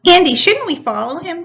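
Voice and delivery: loud, high, urgent question; fretful shout upward; anxious call. Acted. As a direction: call out worried to the others. Tierney calls up, anxious.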